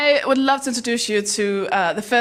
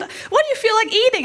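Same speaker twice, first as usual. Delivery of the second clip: high, sing-song eagerness; bubbly question; 10 semitones higher than usual. Acted. Happy best friend asking what to eat, bubbly. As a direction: ask as the relentlessly cheerful friend.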